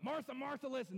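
angry. Shown as a demonstration. Preacher showing how Jesus did not speak, angrily. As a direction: snap her name like a rebuke. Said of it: "You know, it's not".

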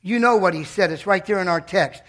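usual speaking voice

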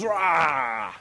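long mock gorilla roar; drawn-out, playful ape roar. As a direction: roar like a man playing gorilla. Man acting out a gorilla roar.

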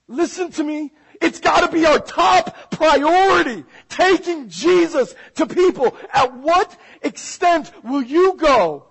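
loud, high, urgent exhortation; preaching at full force. Real, not acted. Preacher pressing the church on its top priority, loud and forceful. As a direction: belt out the challenge to the congregation.

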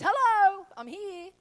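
loud, high sneering hello; mock enemy surprise. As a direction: jump out like an intruder. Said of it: "he wants to come as a surprise".

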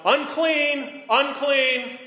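high, loud cry of unclean; leper's loud warning cry; shouted warning. Retold or imitated. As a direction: call out a warning to the crowd. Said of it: "shouting out".

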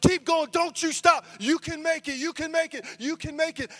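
high, urgent shouts of encouragement; loud start, high pleading repeats; frantic cheering. Retold or imitated. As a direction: yell a tiring swimmer on, losing your mind. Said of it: "I'm losing my mind".

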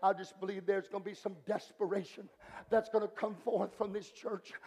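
low, soft pulpit reflection; subdued preacher, phrases set apart by pauses; hushed preaching. Acted. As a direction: bring the sermon right down, low and quiet.

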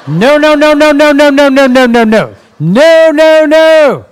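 loud, high repeated no's with a laugh; laughing loud refusal; emphatic no. Real, not acted. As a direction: shout it down laughing.

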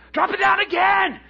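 high, loud urgent order; excited command. Acted. Peter to crew after the catch, excited shouting.

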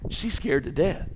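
ordinary delivery

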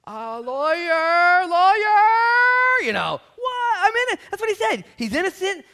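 high, loud drawn-out cry for a lawyer; comic high-pitched yelling; panicked plea. Acted. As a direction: holler in mock alarm for help. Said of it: "You're like".

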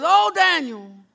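high, loud desperate cry; frantic calling. Acted. Frantic king calling down to Daniel in the den, loud cries. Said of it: "the king's up there all frantic".

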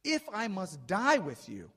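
acted emphatic promise; emphatic vow.

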